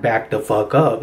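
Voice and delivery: low, no-nonsense dad voice; father's voice. Retold or imitated. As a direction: drop low and warn them off. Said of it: "I call it my father's voice".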